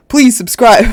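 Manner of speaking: loud, high, giggly please subscribe; laughing plea. Real, not acted. Streamer jokingly begging a viewer to subscribe, laughing loudly. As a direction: beg playfully and crack up.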